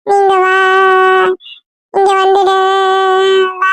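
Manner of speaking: high, drawn-out wail; crying child. Acted. Little boy begging her to come back, crying. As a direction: plead tearfully, stretching the words into a wail. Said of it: "ஏன்டி அவன் அழுவுறான்?".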